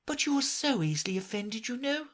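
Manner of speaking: put-on pleading girl. A narrator's reading. Alice pleads.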